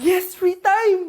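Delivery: high, loud, drawn-out cheer; gleeful cheer. Acted. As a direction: cheer like a freed student. Class hears teacher is absent. (masayang hiyaw)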